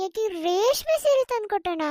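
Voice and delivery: ordinary delivery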